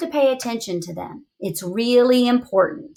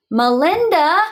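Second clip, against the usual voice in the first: put-on louder call of the child's name; parents calling; 5 semitones higher, 5 dB louder than usual. Mom and Dad calling their daughter, louder. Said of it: "Mom and Dad said".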